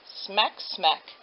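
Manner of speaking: ordinary delivery, nothing marked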